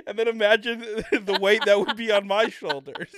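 high, loud laughing speech; cracking up mid sentence. Real, not acted. Laughing hard at own joke.

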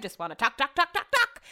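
playful chant of talk, climbing in pitch; rising sing-song. Real, not acted.

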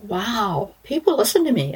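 high, wondering disbelief; amazed. Retold or imitated. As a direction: lift it up in happy awe. Amazed that people listen.

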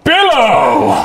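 high, loud command word; command word shout. Acted. Commanding the magic cloak.

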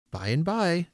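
put-on high-pitched reassuring old man; Father Christmas. A narrator's reading. Old man promising children candles later, high-pitched. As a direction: pitch it up, agreeable and easy. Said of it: "said he in a high-pitched voice".

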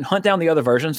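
ordinary talk

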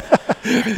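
loud, high-pitched laugh; burst of laughter. Real, not acted. Reacting to the story.

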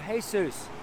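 "Jesus" said the Spanish way, "HAY-soos"; "Jesus" with an h sound and oo vowel, "hay-SOOS"; Spanish pronunciation. Retold or imitated. Preacher showing how the Spanish man says the name, "Jesus" as "hay-soos". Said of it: "in his dialect".

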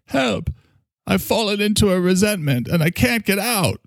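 high, pleading mock cry for help; parody of an old TV ad. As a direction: spoof the helpless ad actor calling out. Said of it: "Remember that commercial?".

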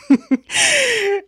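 high giggling burst; helpless high giggles; laughing fit. Real, not acted. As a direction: break up giggling. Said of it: "I'm sorry, I'm laughing".

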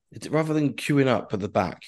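usual speaking voice

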